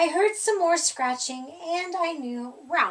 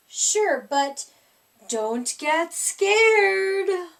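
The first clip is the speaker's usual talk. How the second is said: rasping monster; 3 semitones higher, 5 dB louder, much slower than usual. A narrator's reading. Monster warns the boy.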